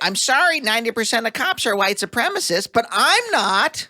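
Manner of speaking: high, put-on pleading voice; imitating a cop protesting his innocence; mock apologetic officer.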